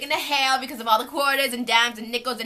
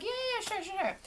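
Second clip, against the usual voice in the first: eager agreement; 11 semitones higher, 7 dB quieter than usual. Retold or imitated. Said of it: "And I was like".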